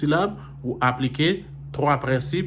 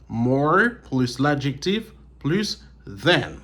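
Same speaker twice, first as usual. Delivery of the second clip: slow dictation; slower than usual. Shown as a demonstration. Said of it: "dictates formula slowly".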